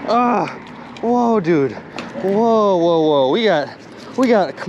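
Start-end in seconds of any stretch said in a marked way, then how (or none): none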